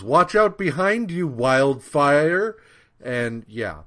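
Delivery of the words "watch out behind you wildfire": high, loud mock shout of warning; imitated warning yell. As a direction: yell it like a bystander tipping someone off.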